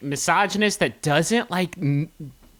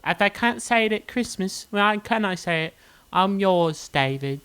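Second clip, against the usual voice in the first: Love Actually note; 5 semitones higher than usual. Retold or imitated. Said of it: "that lady in Love Actually".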